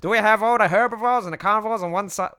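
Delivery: hammed-up fussy rounding-up of a group; Sano character voice. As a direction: call the meeting in a big put-on voice. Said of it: "I just had this voice".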